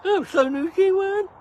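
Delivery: high, loud sneering sing-song; mocking high commenter voice. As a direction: mimic the snide commenter. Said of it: "Dawn Kelly".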